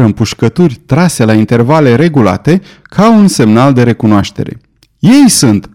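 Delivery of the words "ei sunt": higher, brief call of recognition; exclaimed cry. A narrator's reading. Lord recognising the signal shots of his companions, raised cry. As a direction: cry it out, pitch raised, not full volume. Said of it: "strigă Glenarvan".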